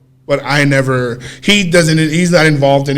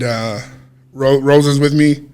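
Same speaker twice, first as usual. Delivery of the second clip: ordinary delivery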